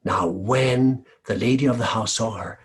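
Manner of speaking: plain reading voice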